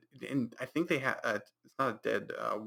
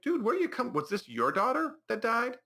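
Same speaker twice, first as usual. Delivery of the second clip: incredulous; 12 semitones higher than usual. Retold or imitated. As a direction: squeal your disbelief at the friend. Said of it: "It's like".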